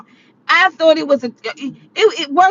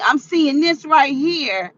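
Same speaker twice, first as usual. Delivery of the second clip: ordinary talk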